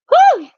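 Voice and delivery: high, loud whew of release; real "whew" after heavy emotion; overwhelmed whew. Real, not acted. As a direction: let out a sharp high whoop of breath. Overwhelmed, lets out a breath.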